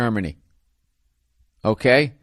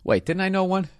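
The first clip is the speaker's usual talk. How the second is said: normal talking voice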